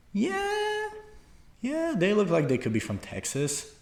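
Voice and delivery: sung-out high yeah, then musing; musing inner voice. Retold or imitated. Mimicking own thoughts on Americans.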